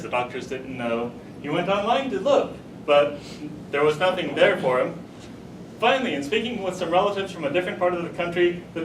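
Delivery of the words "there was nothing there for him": usual speaking voice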